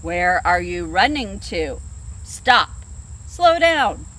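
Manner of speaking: low then loud bear command; bear shouting. Acted. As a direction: bellow stop at them. Bear calling to the animals.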